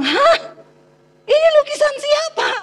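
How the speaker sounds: high-pitched mock shriek of disbelief; retold shocked cry. Husband discovers his wife's painting, retold high shocked cry. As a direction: act out a startled, squealed question. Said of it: "Dia teriak, dia syok".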